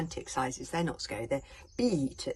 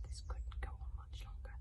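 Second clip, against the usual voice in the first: softer, breathy whispered narration; hushed whisper; 9 dB quieter than usual. A narrator's reading. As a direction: drop to a hush for the grim truth. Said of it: "Hiccup was right".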